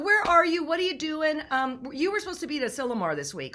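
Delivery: ordinary talk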